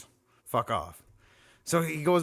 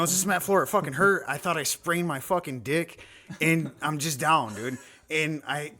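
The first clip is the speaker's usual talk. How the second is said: ordinary talk, nothing marked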